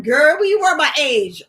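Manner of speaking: loud, high mock challenge; teasing viewer. Viewer questioning the host's worry about age, loud and mocking. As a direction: call it out like a girlfriend teasing. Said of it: "You were like".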